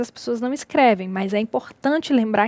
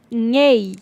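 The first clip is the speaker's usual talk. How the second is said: "nhei" set apart after a pause and drawn out; stressed syllable alone. Shown as a demonstration. Teacher pointing out the strongest syllable, "nhei" said slowly on its own. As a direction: isolate "nhei" and stretch it. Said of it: "a que apresenta maior intensidade fonética".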